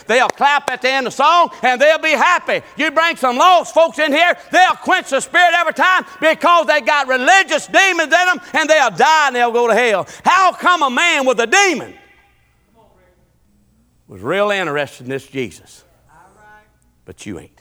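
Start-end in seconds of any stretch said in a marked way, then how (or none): 0.1-11.9 building, high-pitched sermon delivery; full-force preaching. Real, not acted. Preacher warning church about religious demons, forceful and high.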